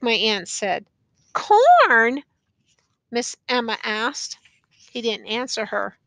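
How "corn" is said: high, loud one-word question. A narrator's reading. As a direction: ask it pitched right up, hopeful of an answer. Old woman coaxing silent prisoner.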